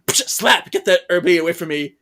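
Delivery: loud, pushy brush-off; irritated rebuff. Retold or imitated. Girl slaps the herbs from his hand, loud irritated rebuff. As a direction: swat it away with the voice.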